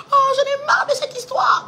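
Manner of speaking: high, loud, exasperated cry; father's outburst. Retold or imitated. Fed-up father yelling at the table, high and loud. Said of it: "quand il me fait ça".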